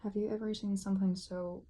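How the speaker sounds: ordinary talk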